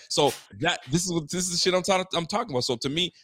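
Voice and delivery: ordinary delivery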